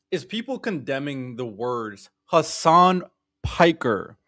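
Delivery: ordinary talk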